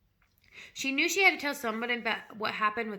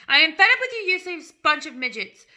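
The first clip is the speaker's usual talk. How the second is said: furious roar, much louder and higher; 6 semitones higher, 14 dB louder than usual. A narrator's reading. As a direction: bellow it at the whole class. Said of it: "roared the Trunchbull".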